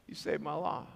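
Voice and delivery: soft, low acted confession; moved, hushed thanks. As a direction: say it quietly, deeply grateful. Moved girl thanking him.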